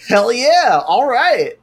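loud, high, eager agreement; eager yes. Retold or imitated. The dude accepts her money, loud and eager. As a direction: jump at the offer. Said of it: "And dude's like".

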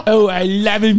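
put-on Cameroonian accent. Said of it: "my Cameroon accent".

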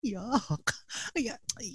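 whispered ay, ay in disgust; whispered disgust at an unwashed guest. Real, not acted. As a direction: breathe it out, grossed out. Doc reacts with disgust.

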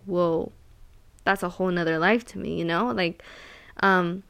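normal talking voice

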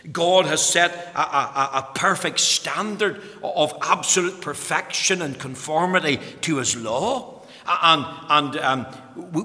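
ordinary delivery

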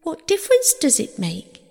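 high-pitched, distressed question; pleading protest. A narrator's reading. Persephone arguing with Hermes about the seeds, pleading. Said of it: "pleaded Persephone".

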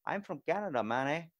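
playful Canadian impression; Canadian accent. Shown as a demonstration. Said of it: "it's like adding the A".

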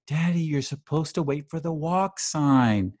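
a hint of a prim six-year-old; imitating little daughter. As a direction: play the small child correcting her dad. Dad voices young daughter scolding.

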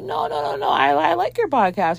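nervous high-pitched denial; lying voice goes high. Retold or imitated. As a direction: deny it, voice climbing high. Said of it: "Her voice is getting, like, very high".

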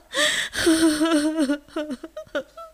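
high, wailing sobs; acted heaving boo-hoo; sobbing. Rabbit walks along crying, heaving sobs. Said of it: "vừa khóc nức nở".

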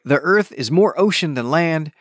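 ordinary talk, nothing marked

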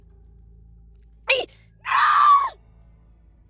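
high, loud agonised scream; scream of agony. A narrator's reading. Blacksmith as his arm snaps, screaming in agony. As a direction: scream out in sudden pain. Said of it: "Zabora screamed in agony".

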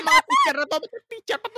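hammed-up shrill tsk-tsk; mock scolding. Friends teasing the teachers, high loud mock scold.

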